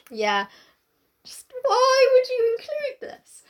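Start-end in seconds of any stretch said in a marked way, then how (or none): 1.2-3.4 slow, airy, drawn-out dismay; appalled disbelief. Real, not acted. Co-host appalled at the book, slow whispery disbelief. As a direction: stretch it out in a whispered, despairing plea.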